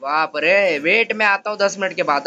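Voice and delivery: normal talking voice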